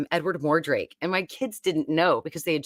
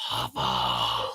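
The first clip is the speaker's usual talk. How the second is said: deep voice; 4 dB quieter than usual. Pretend. Repeats in deep distorted voice.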